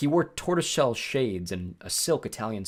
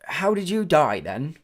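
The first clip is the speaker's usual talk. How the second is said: loud, high British question; British ferryman sizing them up; British-like accent; 7 semitones higher, 7 dB louder, slower than usual. A narrator's reading. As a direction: ask it briskly in a strange British accent. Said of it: "He had a strange accent. British maybe".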